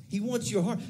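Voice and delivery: ordinary talk, nothing marked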